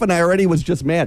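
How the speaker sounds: ordinary delivery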